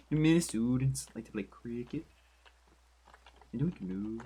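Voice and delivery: playful mock Minnesotan accent. Streamer musing that Minnesotans like cricket, mock Minnesotan accent. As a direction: keep up the put-on Minnesotan accent. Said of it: "this is my more Minnesotan accent".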